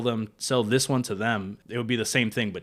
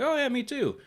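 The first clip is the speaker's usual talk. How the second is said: excited high reply; 4 dB louder than usual. Retold or imitated. Retold sailor's eager reply.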